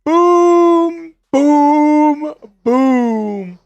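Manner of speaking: booming high hype shouts; hyped boom calls. Real, not acted. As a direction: belt out each boom.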